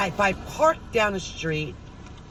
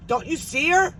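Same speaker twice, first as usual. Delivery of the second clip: high-pitched, insistent question; dad's indignant question; 10 semitones higher than usual. Retold or imitated. Dad insisting daughter must see her, imitated indignant call.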